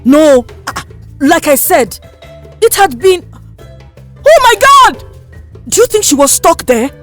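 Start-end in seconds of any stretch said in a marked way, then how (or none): 4.3-6.9 high, loud burst of shock; loud exclamation dropping into a shocked question. Acted. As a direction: gasp it out in horrified disbelief. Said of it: "The matron asked in shock".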